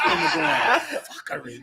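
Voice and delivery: laughing repeat. Real, not acted. Savoring the new word.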